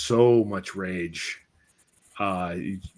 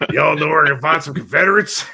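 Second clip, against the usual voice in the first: swaggering general; 5 semitones higher, 7 dB louder than usual. Retold or imitated. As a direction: call out to the street with a cigar. Imagined Sherman in Atlanta.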